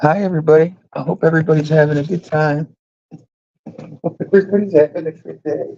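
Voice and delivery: high, fast bubbly greeting voice; Becca impression. Streamer imitating Becca greeting the chat, high and fast. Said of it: "I'll do my best Becca impression".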